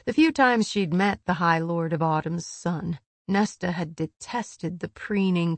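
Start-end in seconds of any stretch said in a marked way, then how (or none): none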